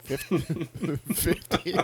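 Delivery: laughing, disbelieving. Real, not acted. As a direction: laugh out the number. Laughs at the huge offer.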